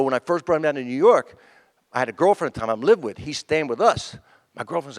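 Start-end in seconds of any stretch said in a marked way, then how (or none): none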